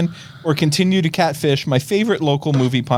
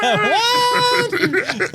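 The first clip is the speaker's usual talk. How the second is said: a very high laughing yelp; disbelieving laugh-scream; laughing shout. Real, not acted. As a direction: shriek it through a laugh. Echoing the shocked reaction.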